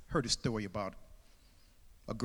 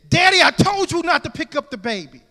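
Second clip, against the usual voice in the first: higher, louder reproach; scolding daughter; 12 semitones higher, 13 dB louder than usual. Acted. Daughter catching her father, higher scolding. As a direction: catch him in the act and scold.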